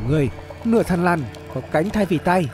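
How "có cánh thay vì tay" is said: usual speaking voice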